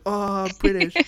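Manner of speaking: playful lovestruck sigh of delight; smitten swoon. Acted. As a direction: gush like a mock-smitten fan. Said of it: "my em-, emotional brain".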